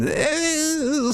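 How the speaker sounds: thin, very high drawn-out shriek; high scream. Acted. Robed man lashed by the wire, shrieking. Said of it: "screams and then lets out".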